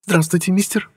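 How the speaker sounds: high-pitched female character greeting; friendly shop girl. A narrator's reading. Shop girl behind the counter greets a customer, high-pitched.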